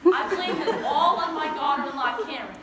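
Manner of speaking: loud, indignant accusation; loud blaming. Acted. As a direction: pin the blame at full volume. Grandma's rant about daughter-in-law.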